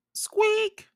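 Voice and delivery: tiny very high squeal; mouse squeak. A narrator's reading. Peanut the mouse pipes up from Annie's pocket, high squeak.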